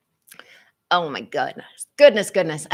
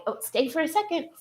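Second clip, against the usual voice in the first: much higher, fast sing-song "stay"; coaxing the dog; 11 semitones higher, faster than usual. Real, not acted. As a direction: coax the dog with a bright, quick plea.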